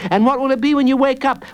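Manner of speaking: put-on high, quick little boy; child's voice. A narrator's reading. Jacob quizzes the villain, high quick child voice. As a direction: pipe it up high and fast.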